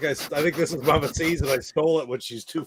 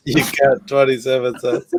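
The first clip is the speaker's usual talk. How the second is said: laughing imitation; 8 dB louder than usual. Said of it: "He's just like this".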